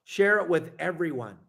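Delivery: ordinary delivery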